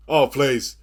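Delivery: ordinary delivery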